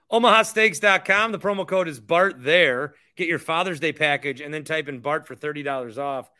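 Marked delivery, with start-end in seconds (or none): 0.1-4.9 ad read. Acted. Sponsor ad read.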